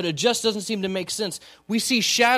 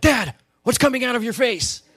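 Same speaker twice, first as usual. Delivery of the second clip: put-on higher, louder voice of a puzzled child; kids asking; 6 semitones higher, 4 dB louder than usual. Pastor imitating his kids seeing him cry, higher and puzzled. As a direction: play the curious little kid. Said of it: "and they're like".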